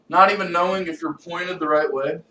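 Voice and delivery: usual speaking voice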